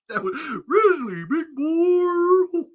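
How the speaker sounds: high, slow goofy voice; silly high voice. Pretend. Signing off the bit, squeaky goofy voice.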